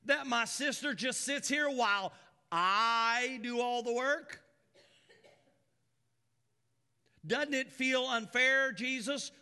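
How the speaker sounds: put-upon Martha griping to Jesus; whiny Martha. Acted. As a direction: whine like an overworked sister. Preacher voicing complaining Martha.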